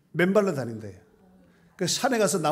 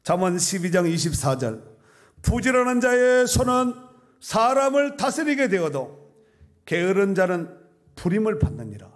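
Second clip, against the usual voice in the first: loud, high proverb proclamation; full-force preaching; 6 semitones higher, 7 dB louder than usual. Real, not acted. Preacher proclaiming a proverb on diligence, full-force preaching. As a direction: ring the verse out from the pulpit.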